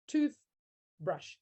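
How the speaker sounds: TOOTHBRUSH broken in two, TOOTH, pause, BRUSH; split into syllables. Shown as a demonstration. Teacher showing two syllables, splitting toothbrush into tooth and brush. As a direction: say "toothbrush" as two separate beats with a clear gap. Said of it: "that's two syllables".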